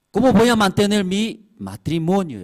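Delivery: high, loud rhetorical cry; loud preaching question. Acted. Preacher pleading for marriages, loud and high.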